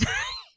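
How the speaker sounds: a quick squeaky laugh; high burst of laughter. Real, not acted. Reader laughing at her own impression, squeaky laugh.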